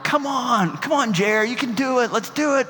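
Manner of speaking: high, eager coaxing call; siblings cheering. Retold or imitated. Older siblings coaxing the youngest off the diving board, high and eager. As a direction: egg the little brother on from the pool.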